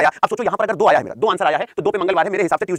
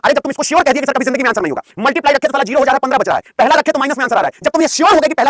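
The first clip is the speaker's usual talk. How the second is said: louder, higher exasperated scolding; heated scolding; 6 semitones higher, 6 dB louder than usual. Real, not acted. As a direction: raise your voice at the students in frustration. Teacher scolds students heatedly. (झल्लाकर ऊँची आवाज़ में डाँटना)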